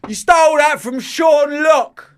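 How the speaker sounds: high, loud, slow accusing shout; heckler accusation. Retold or imitated. Heckler naming the comic he was accused of copying. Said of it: "And he goes".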